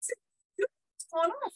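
drawn-out high disbelief; slow high incredulous. Retold or imitated. Telling the host the cheese had gone off, slow and high. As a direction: stretch it out in disgust.

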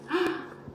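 mock gasp. Excited reveal of hair.

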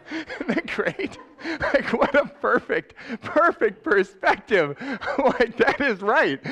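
high and laughing, slowed in delight. Real, not acted. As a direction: chuckle through the praise. Delighted after principal's quote.

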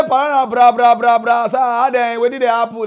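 loud, urgent phone greeting from a friend; excited caller. Retold or imitated. Caller from Ghana phones him, loud and excited.

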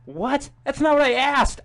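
high, loud protest; high indignant objection; exasperated. Real, not acted. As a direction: complain in disbelief at the phone. Arguing with Siri's wrong answer.

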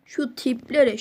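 ordinary delivery

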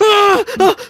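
high, loud mock yelp; imitated scream. [name] imitating Ty in the background, high mock yelps. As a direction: yelp like Ty screaming for his life. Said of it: "Screaming for his life".

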